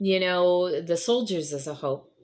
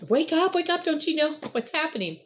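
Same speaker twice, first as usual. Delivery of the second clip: much higher, urgent mock panic; frantic wake-up call; 10 semitones higher than usual. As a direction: rouse him like the boat is sinking. Disciples rousing sleeping Jesus.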